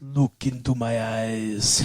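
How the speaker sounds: slow, low hypnotist impression; mock-sinister, low and slow; Bela Lugosi. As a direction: imitate an old horror star, drawn out and hushed. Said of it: "He played Bela Lugosi from Ed Wood".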